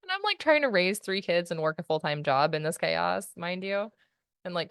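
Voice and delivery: normal talking voice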